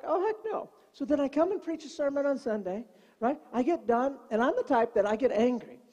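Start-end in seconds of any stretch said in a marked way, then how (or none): 0.0-0.7 high mock dismay. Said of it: "I'm like".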